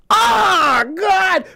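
high, drawn-out agonized yell; hammed-up howl from a brick hit; mock scream of pain. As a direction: cry out as if struck. Acting victim hit by another brick.

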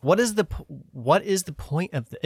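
stunned, can't believe it; incredulous. Real, not acted. Reacting to pin image.

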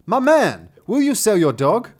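loud, high hail from a carriage; gentleman calling out. Acted. Gentleman calling from a carriage to buy a dog, loud hail. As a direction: call across to a stranger as a gentleman. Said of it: "the gentleman calls from the carriage".

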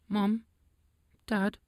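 put-on high, small child's voice; a little foal calling her parents; young unicorn child. Young unicorn Harp calls parents.

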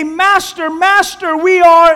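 high, loud frightened cry to the master; panicked plea. Acted. Disciples crying out to sleeping Jesus, frightened and loud. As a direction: beg him to wake, terrified.

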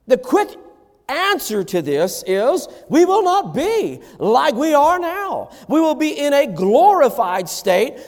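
high, ringing sermon delivery with hard-hit key words; forceful preaching. Real, not acted. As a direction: preach it out, pitched high and emphatic.

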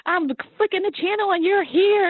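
a mock overwhelmed caller, high-pitched; tearful godparents. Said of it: "They're like".

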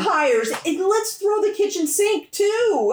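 high, stretched sarcastic pile-on; sarcastic exasperation. Real, not acted. Reader mocking the overloaded plot, sarcastic exasperation. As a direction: throw up your hands at the absurdity, pitched high.

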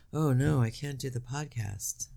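slow, soft hammed-up regret; mildly mocking regret over the podcast; slow mock lament. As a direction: drag it out like fake sorrow. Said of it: "And I said".

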